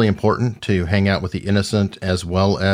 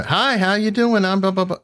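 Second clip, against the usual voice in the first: mock chatty Southerner; 11 semitones higher than usual. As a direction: ham up an eager friendly Southerner. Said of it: "so you probably could go".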